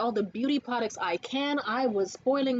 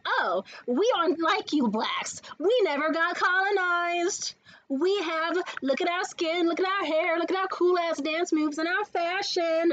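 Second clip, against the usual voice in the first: higher, boastful imitation, words stretched; mock superiority; 6 semitones higher, slower than usual. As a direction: show off haughtily, as a parody.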